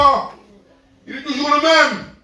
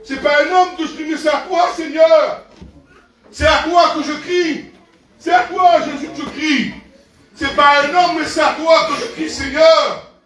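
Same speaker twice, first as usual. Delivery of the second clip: raised, fervent prayer voice; 4 semitones higher, 3 dB louder than usual. Acted. Preacher leading the prayer, fervent and raised. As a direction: cry out to the Lord.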